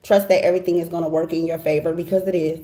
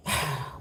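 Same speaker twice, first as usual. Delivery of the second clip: emotional sigh; 4 semitones lower, 11 dB quieter than usual. Real, not acted. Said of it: "I don't wanna get emotional".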